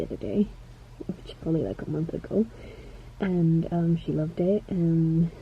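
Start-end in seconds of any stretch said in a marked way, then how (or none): none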